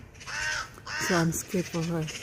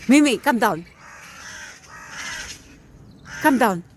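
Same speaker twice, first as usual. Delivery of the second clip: much louder, higher shout up the tree; urgent calling; 3 semitones higher, 6 dB louder than usual. Real, not acted. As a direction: shout up to the cat, loud and urgent.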